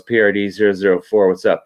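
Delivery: usual speaking voice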